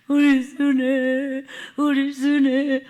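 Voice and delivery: slow, grieving repetition of the name; tearful murmur. Retold or imitated. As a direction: linger on the name as if in tears. Mother weeping over daughter.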